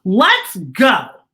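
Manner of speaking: loud, high little cheer; a girl cheering for ice cream. A narrator's reading. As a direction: cheer it out like a happy child. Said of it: "Katie cheers and says".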